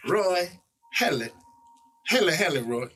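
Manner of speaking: TV police chief. Retold or imitated. Actor mimicking a TV police chief calling his lieutenant, Southern chief voice. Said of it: "he used to be like".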